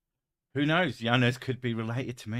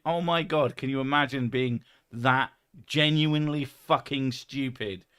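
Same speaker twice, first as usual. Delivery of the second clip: ordinary delivery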